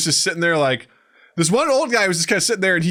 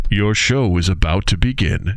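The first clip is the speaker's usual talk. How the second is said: low, slow announcer voice; mock-solemn deep announcement; show announcer; 7 semitones lower, slower than usual. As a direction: intone it like a theatre announcement. Imitates announcement before show.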